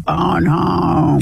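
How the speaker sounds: drawn-out, lightly imitated E.T. voice; E.T. impression. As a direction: stretch it out like a casual E.T. imitation. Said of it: "They go".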